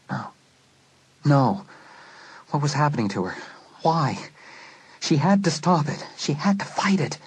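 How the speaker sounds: climbing alarm, fighting off the dark; rising panic. Acted. Charity waking from the dream, frightened and rising.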